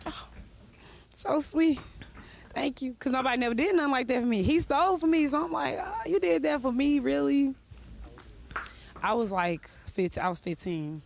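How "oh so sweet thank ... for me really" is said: mock touched girlfriend.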